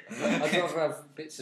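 amused laugh then joke. Real, not acted. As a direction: chuckle into the words.

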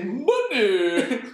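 high, loud mock-posh voice; hammed-up toff, pitched right up. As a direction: put on a loud, silly posh accent. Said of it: "did money in a posh voice".

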